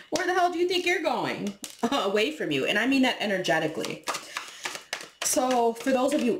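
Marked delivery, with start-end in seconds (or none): 0.1-1.5 high mock outrage at someone leaving; indignant ex. Toxic ex calling after someone leaving, high mock outrage. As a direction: squeal it like a jilted ex. Ex confronting someone walking away.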